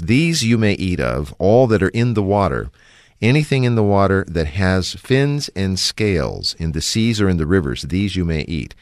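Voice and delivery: loud, measured reading of scripture. Acted. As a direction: read the verse out with weight. Reads scripture verses aloud.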